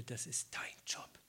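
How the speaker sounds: softly whispered "Das ist dein Job"; sulky whisper; whispered retort. Retold or imitated. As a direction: hiss it under your breath. Muttered inner retort.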